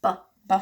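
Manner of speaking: "B, b." as a short bare buh sound; "b" voiced as just the sound, not the letter name. Shown as a demonstration. Parent showing a child how "B, b." sounds. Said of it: "B sounds like this".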